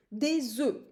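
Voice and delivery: "des œufs" linked with a Z sound; teacher showing the Z liaison in "des œufs". Shown as a demonstration. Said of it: "like Z".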